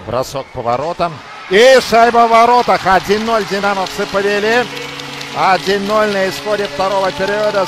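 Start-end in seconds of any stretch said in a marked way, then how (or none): 1.5-7.6 excited hockey goal call. Real, not acted. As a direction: call the goal with a burst of joy. Goal scored, commentator calls it. (взволнованный крик при голе)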